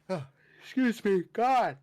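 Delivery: high, loud words through a yawn. Real, not acted. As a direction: yawn while talking. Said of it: "why am I so yawny?".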